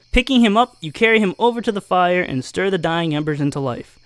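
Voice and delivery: normal talking voice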